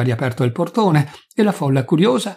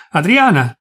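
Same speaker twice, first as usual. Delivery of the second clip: raised voice searching for someone in the dark; loud call; 3 semitones higher, 5 dB louder than usual. A narrator's reading. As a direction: call the name out loudly. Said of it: "chiamò forte". (richiamo a voce alta)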